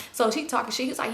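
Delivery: ordinary delivery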